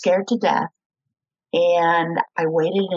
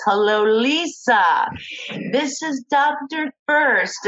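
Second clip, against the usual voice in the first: slow, higher South African doctor greeting; a light South African accent, unhurried and higher; 6 semitones higher, slower than usual. Retold or imitated. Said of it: "this beautiful South African man with this unbelievable accent".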